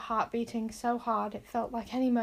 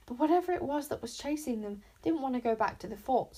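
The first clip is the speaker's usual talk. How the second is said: ordinary delivery, nothing marked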